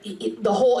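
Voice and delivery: ordinary talk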